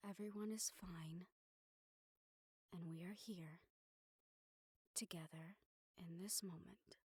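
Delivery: tender hushed calm; soothing whisper. Acted. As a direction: whisper to bring him back to now. Grounding the panicked general.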